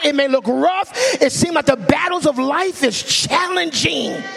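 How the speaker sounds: high, loud preaching at full force; real preacher's yell. Real, not acted. Preacher rallying the church that God has their back, yelling. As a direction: shout the hope out to the congregation. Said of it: "ain't supposed to yell".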